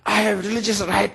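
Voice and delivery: mock indignant insistence; insistent protest. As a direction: push back at the guard. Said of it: "I said".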